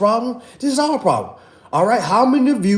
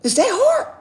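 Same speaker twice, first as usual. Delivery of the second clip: mock exasperated; 13 semitones higher than usual. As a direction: act fed up with the complaint. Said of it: "I'll be like".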